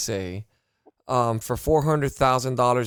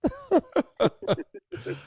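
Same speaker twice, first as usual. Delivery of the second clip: mock crying laugh. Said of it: "that girl that was laying on the floor yelling".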